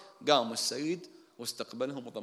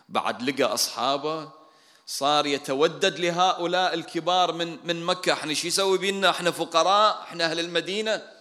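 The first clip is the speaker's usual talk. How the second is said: raised, higher voice of indignant protest; aggrieved complaint; 5 semitones higher, 7 dB louder than usual. Retold or imitated. Ansar grumbling over the spoils, aggrieved. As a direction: complain loudly on behalf of the slighted. الأنصار يتذمرون من توزيع الغنائم.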